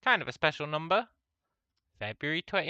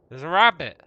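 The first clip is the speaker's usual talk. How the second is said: much louder, thrilled exclamation; excited; 2 semitones higher, 10 dB louder than usual. Real, not acted. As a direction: call it out with delight. Spots a rabbit in game.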